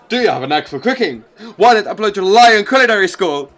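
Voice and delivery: fast, laughing sales pitch; chirpy ad pitch. Acted. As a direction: pitch the cooking school cheerfully. Recruitment ad pitch.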